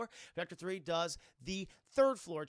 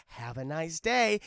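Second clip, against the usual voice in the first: slow robot sign-off, louder at the end; robot catchphrase; 7 dB louder, slower than usual. Acted. Streamer voices protectors' catchphrase, slow robot delivery. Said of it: "the other protectors say".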